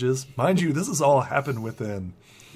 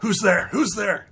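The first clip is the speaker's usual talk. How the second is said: high, loud startled call; alarmed challenge; 10 semitones higher, 7 dB louder than usual. Acted. Bandits challenging an unseen intruder, loud alarmed shout. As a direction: call out sharply into the dark.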